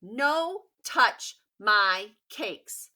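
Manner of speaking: loud and slow, each word weighted; guard the cakes; a slow loud little-girl warning; firm refusal. Acted.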